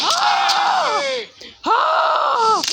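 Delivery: repeated high-pitched "Ah!" screams. Acted. Carl dying in the game, high screams. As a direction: scream in short high bursts.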